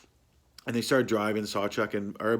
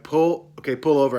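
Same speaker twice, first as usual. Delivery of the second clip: louder, mock-urgent demand to stop; retold nauseous plea to the driver; 4 semitones higher, 7 dB louder than usual. Retells begging driver to stop.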